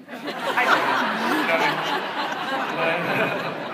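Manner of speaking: real laugh breaking up the words; high, loud, helpless laughing talk. Real, not acted. Speaker cracking up with the audience over the praying joke, laughing.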